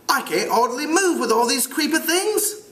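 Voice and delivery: high British schoolboy voice; British accent. Acted. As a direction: play a piping British boy. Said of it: "try to do it with a British accent".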